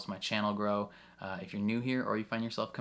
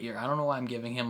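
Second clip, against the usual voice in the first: normal talking voice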